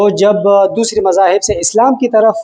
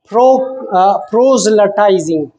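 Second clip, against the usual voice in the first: "proselytizing" said slowly, syllable by syllable; teacher sounding out "proselytizing" slowly; slow careful pronunciation; much slower than usual. Shown as a demonstration. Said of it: "this is a little bit difficult word".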